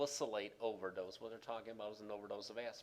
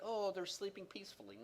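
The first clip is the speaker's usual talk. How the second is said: much higher-pitched mock-innocent voice; mock naive; 10 semitones higher than usual. Lecturer mimics someone wrongly assuming ICU patients rest, mock naive. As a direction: imitate a naive onlooker, pitched well up. Voicing naive onlooker, then "Nonsense.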